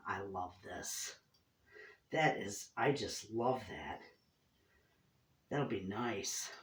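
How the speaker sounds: quiet, slow, tender delight; soft and tender. Real, not acted. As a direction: coo over it gently. Cooing softly over gift.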